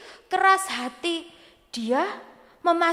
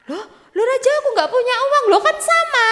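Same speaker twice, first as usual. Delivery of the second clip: tinggi, merengek tertawa gugup; memelas kepada raja; 11 semitones higher, 4 dB louder, faster than usual. Acted. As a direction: merengek minta dimaklumi raja. Orang itu memelas kepada raja. (merengek dengan tawa gugup)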